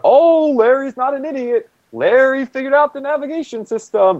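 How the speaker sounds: very high, held-up singsong boast; comic high-pitched mock bragging; Larry's funny voice. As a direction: crow it in a silly high voice. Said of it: "like, in his funny voice".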